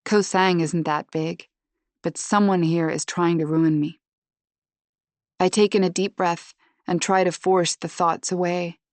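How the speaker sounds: plain reading voice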